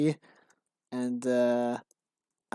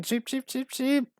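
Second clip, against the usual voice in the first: high-pitched chicken cheeping sound; mock chicken cheeps; 10 semitones higher than usual. Quest giver mocking the player as a chicken, high cheeps. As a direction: cheep like a taunting little chick. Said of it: "You're just a little chicken".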